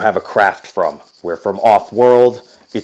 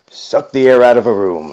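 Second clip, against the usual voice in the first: new tagline; 4 semitones higher, 6 dB louder than usual. Acted. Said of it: "I guess that's my new one".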